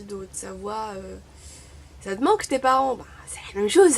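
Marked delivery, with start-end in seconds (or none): 2.0-3.0 louder, higher pointed question; pointed retort. Real, not acted. Retort to the asker. 3.0-3.9 much louder, insistent; emphatic retort. Real, not acted. Retort to the asker.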